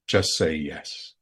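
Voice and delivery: lightly voiced angel, slow and measured; slow, calm inner-voice instruction; angel's voice. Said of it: "I heard my angel's voice".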